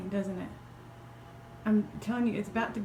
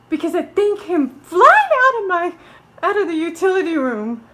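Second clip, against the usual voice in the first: frightened, raised-voice retelling; real fright; 6 semitones higher, 12 dB louder than usual. Real, not acted. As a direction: blurt it out loud and high, still rattled. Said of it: "got the crap scared out of me".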